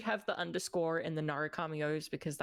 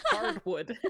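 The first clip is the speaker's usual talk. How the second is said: word squeezed out through laughter; cracking up mid-word; laughing; 4 dB louder than usual. Real, not acted. Laughing over pronunciation.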